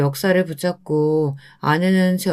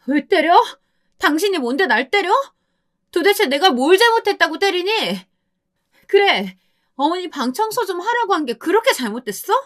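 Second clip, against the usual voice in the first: shrieking wife; 12 semitones higher than usual. Acted. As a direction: scream back at the husband who hit her. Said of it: "고래고래 소리를 질렀습니다".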